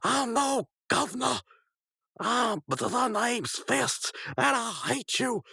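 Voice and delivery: high-pitched defiant cartoon hero; high mock-furious fighting talk; Rigby. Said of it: "And then Rigby says".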